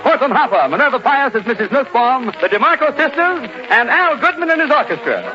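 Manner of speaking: ordinary talk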